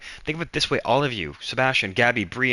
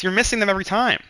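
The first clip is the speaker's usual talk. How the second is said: exasperated outburst; 8 semitones higher, faster than usual. Real, not acted. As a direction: snap it out in frustration. Arguing heatedly with Devin.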